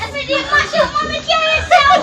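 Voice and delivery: loud exasperated yell; frustrated shout. Real, not acted. Yelling at the game character who keeps dying. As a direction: shout your frustration at the screen.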